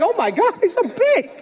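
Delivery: put-on high, amazed gasp at the huge kid; shocked exclaim. Father on the sideline gasping at the giant opponent, amazed. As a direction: sell the shock at his size. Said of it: "I'm like".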